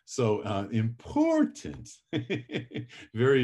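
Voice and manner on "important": "important" stretched with a high leap in the middle; exaggerated rising pitch on "important"; pitch going up. Shown as a demonstration. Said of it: "make your voice go up".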